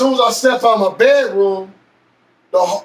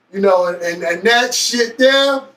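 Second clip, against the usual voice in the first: ordinary delivery, nothing marked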